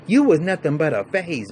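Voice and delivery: a retold angry put-down; mock outburst. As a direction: throw it at her. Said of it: "She said".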